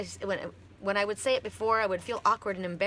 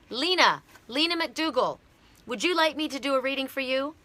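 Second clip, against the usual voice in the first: raised, higher voice hailing someone by name; calling out; 5 semitones higher, 4 dB louder than usual. Real, not acted. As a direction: call to her and offer eagerly. Calls out to a viewer by name.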